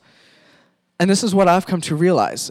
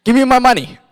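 upset demand; 8 semitones higher, 7 dB louder, faster than usual. Acted. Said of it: "Elsie's quite upset".

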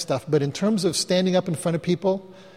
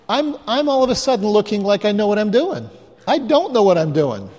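usual speaking voice

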